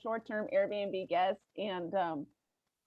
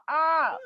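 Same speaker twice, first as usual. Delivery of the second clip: opossum hiss; 7 semitones higher, 5 dB louder than usual. Retold or imitated. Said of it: "all they do is they go".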